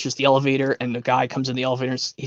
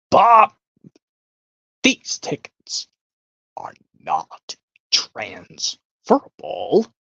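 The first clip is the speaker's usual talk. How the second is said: put-on stern old matriarch; 4 semitones lower, much slower than usual. Conductor impression quote.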